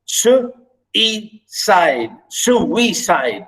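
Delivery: "suicide" first in separate slow beats, then joined up; su... i... cide, each syllable set apart. Shown as a demonstration. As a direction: demonstrate the three syllables of "suicide". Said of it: "Notice the three syllables".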